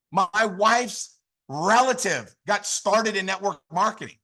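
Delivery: normal talking voice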